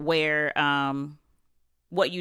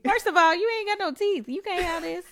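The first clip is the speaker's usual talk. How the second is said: high playful scolding voice; mock scolding; 13 semitones higher than usual. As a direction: scold a toddler with a grin. Parent scolding toddler in play.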